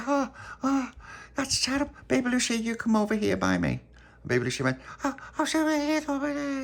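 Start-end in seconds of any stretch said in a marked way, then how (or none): 0.1-3.8 much higher, worried motherly voice; high anxious fussing; fussing girl voice. Acted. Charlotte fussing over Baby Lucy. 5.0-6.6 put-on very high, small little-kid voice; high babyish mumble. Baby Lucy speaks.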